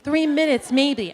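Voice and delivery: ordinary talk, nothing marked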